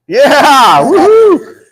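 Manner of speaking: loud, high whooping cheer; excited cheer. Real, not acted. Host cheering the Texans in the audience, loud excited whoop. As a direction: whoop it up for the crowd.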